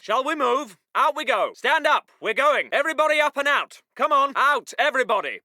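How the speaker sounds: low, matter-of-fact officer; calm chivvying. Acted. Officer getting chaps up and out, calmly brisk. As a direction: move them along without fuss. Calm version of move order.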